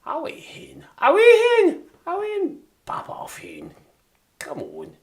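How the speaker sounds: high, shrill-free squeal of Scottish auntie, loud; Scottish hen. Retold or imitated. Showing chat how Scots use hen, squealed Scottish voice. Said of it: "they use pet and hen".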